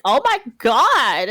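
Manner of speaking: loud, drawn-out mock exclamation; flustered. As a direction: cry out slowly in acted exasperation. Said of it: "flustered. She's like".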